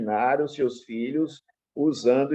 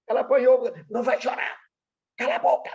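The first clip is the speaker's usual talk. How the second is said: pai severo imitado; 11 semitones higher, faster than usual. Retold or imitated. Pai severo ralhando com a criança.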